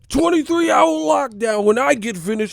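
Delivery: explosive high shouted tirade; Denzel. Acted. Governor as movie cop imposing lockdown, furious high shouting. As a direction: explode like a crooked cop losing control. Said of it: "like Denzel and shit in Training Day".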